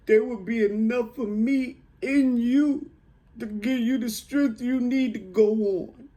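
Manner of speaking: high, loud voice building in force; impassioned motherly declaration. Retold or imitated. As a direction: proclaim it to a son, swelling to full power. Climax of mother's dying message.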